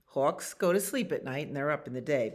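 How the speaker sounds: ordinary delivery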